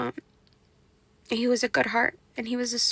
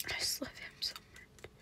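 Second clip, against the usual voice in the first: much softer, breathless whispered sob; crying whisper; 13 dB quieter than usual. Real, not acted. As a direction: whisper it as tears take over. Crying over Job's story.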